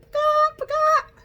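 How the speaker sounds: very high, loud hen call on paka; high clucking hen noise. Shown as a demonstration. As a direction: squawk it like a hen. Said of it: "You ready?".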